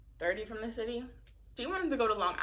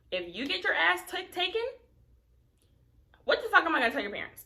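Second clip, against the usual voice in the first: angry scolding; 9 semitones higher, 6 dB louder, faster than usual. Retold or imitated. Scolding underage friend.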